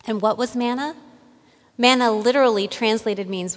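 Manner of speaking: usual speaking voice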